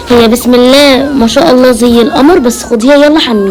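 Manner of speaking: very loud, at normal pitch; loud handover. Acted. Handing over the newborn girl to be bathed, very loud. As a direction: project hard, as over a crying baby. Calling over noise about the baby.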